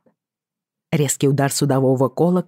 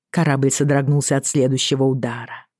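usual speaking voice

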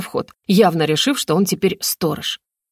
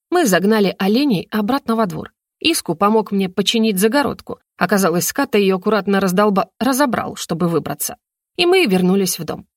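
ordinary delivery

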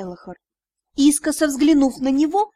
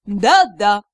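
lower, loud sarcastic yes-yes; dripping sarcasm; 5 semitones lower, 6 dB louder than usual. Acted. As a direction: drip sarcasm on every syllable. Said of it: "Сарказм плещет через край".

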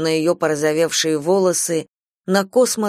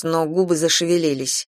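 usual speaking voice

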